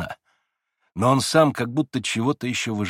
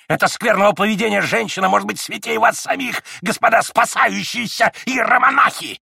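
put-on high, loud shrill ranting old man; furious ranting; 8 semitones higher, 5 dB louder than usual. A narrator's reading. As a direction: rail at the monks.

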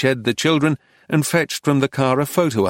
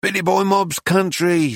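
raised, drawn-up proud tone; exhausted dignity; 7 semitones higher than usual. A narrator's reading. Woman defines her right to exist, with weary dignity. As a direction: rouse yourself and claim it with dignity. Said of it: "rousing herself with exhausted dignity".

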